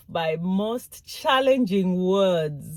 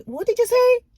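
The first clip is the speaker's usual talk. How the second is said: high, loud mocking question; 6 dB louder than usual. Negative listener to someone with an accent, mocking. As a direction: mimic a mocker, pitched way up and louder. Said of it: "mocking you or laughing".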